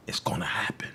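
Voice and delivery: a low whispered warning. Real, not acted. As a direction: drop to a sudden whisper. Menacing warning to rivals on live.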